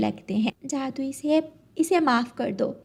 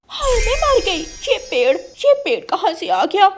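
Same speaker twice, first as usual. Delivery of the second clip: high, loud startled gasp of a line; startled bird exclaiming, much higher and louder; shocked cry; 8 semitones higher, 6 dB louder than usual. Acted. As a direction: squawk it out in shock. Crow shocked by sudden tree.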